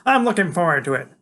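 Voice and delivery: put-on high, loud, lofty kid genius; Freak's voice. A narrator's reading. Freak's character voice.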